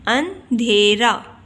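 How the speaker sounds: अंधेरा slow, broken into syllables; slow model pronunciation. Shown as a demonstration. Teacher repeats the word for learners, अंधेरा slowly split up.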